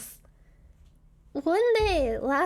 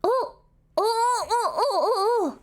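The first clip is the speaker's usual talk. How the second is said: excited; 5 semitones higher than usual. Real, not acted. Finds the old screenshot.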